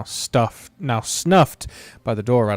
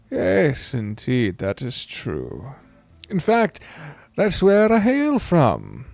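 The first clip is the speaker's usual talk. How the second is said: put-on slow, unhurried old man's drawl; 3 semitones higher, slower than usual. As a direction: stretch it out like a grandparent telling a tale.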